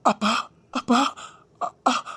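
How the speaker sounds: choked sobbing calls; broken crying. Acted. Son hearing his father is critical, sobbing. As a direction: break down calling for father.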